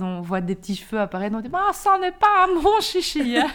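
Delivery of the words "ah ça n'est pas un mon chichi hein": slow, high, loud Flemish caricature; Flemish accent. Retold or imitated. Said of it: "elle avait un fort accent flamand". (accent flamand exagéré, lent, aigu et fort)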